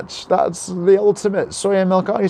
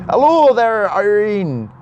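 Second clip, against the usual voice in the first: higher, louder mock Scottish greeting; attempted Scottish accent; 3 semitones higher, 3 dB louder, slower than usual. Streamer greeting a viewer called Irene, shaky attempt at Scottish accent. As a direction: put on a dubious Scottish lilt. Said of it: "if that's a Scottish accent".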